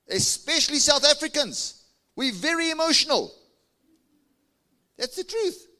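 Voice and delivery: high, loud jab at the crowd; fiery preaching. Acted. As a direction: tease the listeners from the pulpit. Preacher teasing the congregation.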